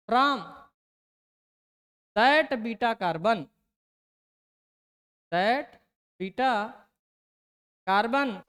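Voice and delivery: very slow, word-by-word dictation with long pauses; dictating a rule. Acted. Teacher reading out the English rule for students to note, slow dictation. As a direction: stretch the words as if writing each one.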